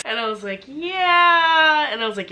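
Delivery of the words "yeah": high, drawn-out embarrassed yeah; sheepish yeah. Retold or imitated. Answering the trainer who offers a spot, sheepish and high.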